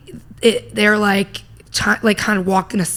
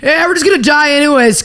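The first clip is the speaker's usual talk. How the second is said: teacher's announcement; 6 semitones higher, 5 dB louder than usual. Retold or imitated. Retelling teacher's blunt announcement.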